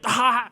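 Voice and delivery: sudden high mock shriek; imitated high shriek; high yelp. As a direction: let out a high startled yelp. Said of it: "being like".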